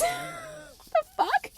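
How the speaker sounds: laughing disbelief. Real, not acted. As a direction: laugh it out, stunned. Disbelieving laugh over the keys.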